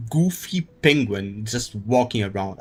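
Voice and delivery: ordinary delivery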